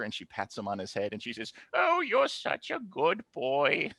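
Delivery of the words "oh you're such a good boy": put-on high, slow doting old granny voice; high-pitched, drawn-out grandmotherly praise; doting grandma. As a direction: coo slowly like a fond grandma. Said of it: "Grandma moves over to the manager".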